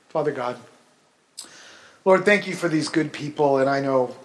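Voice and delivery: hushed pastoral prayer. Acted. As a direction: pray gently over the congregation. Leads a prayer.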